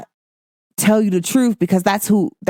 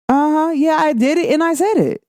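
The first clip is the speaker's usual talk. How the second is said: defiant reply; 8 semitones higher than usual. Retold or imitated. Her defiant retold reply.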